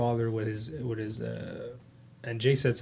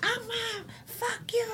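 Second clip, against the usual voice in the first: put-on much higher, softer bratty kid voice; mock tantrum; 6 dB quieter, slower than usual. Mocking a Karen throwing a tantrum at her mom, high bratty voice. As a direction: squeak it at Mom like a spoiled child stomping. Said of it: "like, she would stomp".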